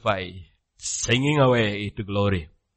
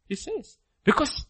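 usual speaking voice

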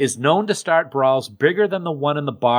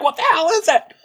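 much higher, exaggerated indignant cry; mock outraged crowd; 3 dB louder than usual. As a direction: play the baffled fans. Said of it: "All the people are like".

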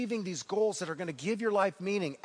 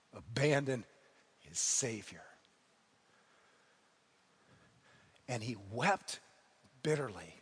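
hushed grief; 7 semitones lower, 6 dB quieter than usual. Acted. Peter's betrayal, sombre climax.

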